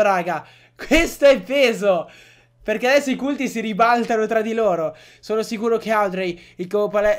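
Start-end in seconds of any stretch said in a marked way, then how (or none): 0.7-2.1 slow, loud, punching è; emphatic repeat. Real, not acted. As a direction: hit è hard and slow. Stunned by game twist.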